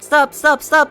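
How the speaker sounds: ordinary delivery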